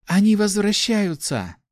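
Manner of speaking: high, drawn-out happy exclamation; overjoyed announcement. A narrator's reading. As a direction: burst out with the good news, pitched high. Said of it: "с глазами полными счастья произнесла она".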